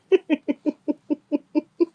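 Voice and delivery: high, loud run of giggles; giggling. Real, not acted. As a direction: giggle helplessly at the memory. Giggles remembering the story.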